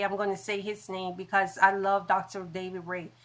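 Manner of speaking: ordinary talk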